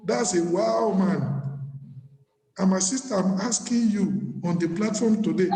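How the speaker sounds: ordinary talk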